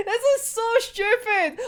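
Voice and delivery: helpless high laughing voice; real laughter. Real, not acted. Fan cracking up at silly banter, laughing helplessly. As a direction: squeeze the words out through giggles.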